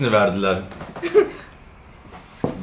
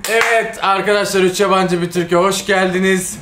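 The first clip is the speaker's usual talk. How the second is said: higher, louder TV host opening; show host intro; 7 semitones higher, 4 dB louder than usual. Acted. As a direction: greet the audience like a host.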